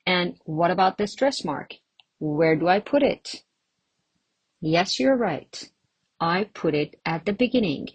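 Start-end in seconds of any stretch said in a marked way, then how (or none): none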